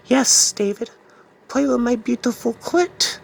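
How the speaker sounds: high, sultry witch voice; seductive witch. Retold or imitated. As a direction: purr it like a wicked witch. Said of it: "she's like".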